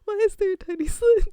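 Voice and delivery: high, loud, through laughter; laughing in alarm. Real, not acted. Streamer reacting to the game, high laughing alarm. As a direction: blurt it out, cracking up.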